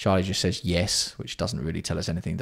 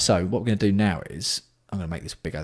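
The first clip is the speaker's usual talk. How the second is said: ordinary talk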